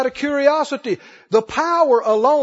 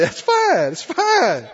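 reassuring; 6 semitones higher than usual. Retold or imitated. Jesus reassures the woman.

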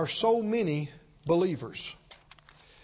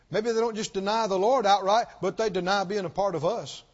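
ordinary delivery

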